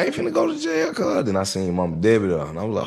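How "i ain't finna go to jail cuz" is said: high, panicky protest; a frightened kid pleading innocence; scared. Retold or imitated. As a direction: blurt it out in a high fearful voice. Scared of going to jail.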